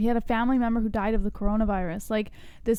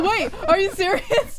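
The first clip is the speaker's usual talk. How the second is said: higher, slower, through laughter; laughing disbelief; 9 semitones higher, 3 dB louder, slower than usual. Retold or imitated. [name] retelling falling for the prank, laughing. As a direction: giggle out the doubt. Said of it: "I was like".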